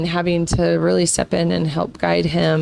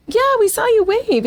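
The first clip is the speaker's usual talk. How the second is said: much higher, bright cheery reply; cheerful family reply. Retold or imitated. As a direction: toss it back brightly like proud parents. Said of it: "And we were like".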